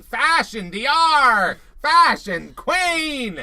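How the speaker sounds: high, very loud, drawn-out mock scream; imitated screaming. Host imitates what the show left out, slow high screaming. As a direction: bellow it slowly, stretching the last words. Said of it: "show him screaming".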